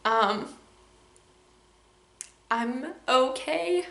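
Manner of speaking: quiet, slow, choked-up words near tears; fighting back tears. Real, not acted. Vlogger to her viewers, on the verge of real tears. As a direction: hold it together as the tears come. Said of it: "bust out in tears right now".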